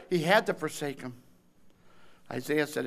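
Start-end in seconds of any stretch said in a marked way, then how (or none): none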